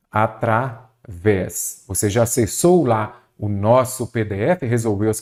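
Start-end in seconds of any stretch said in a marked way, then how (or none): 0.1-1.8 "Através" drawn out slowly, broken into its syllables. Shown as a demonstration. Teacher spells out the correct answer for students, syllable by syllable. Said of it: "O correto aqui é o quê?".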